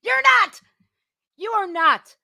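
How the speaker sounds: loud, laughing shout. Real, not acted. Vlogger answering the clip, laughing loud shout. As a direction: yell the verdict at the screen.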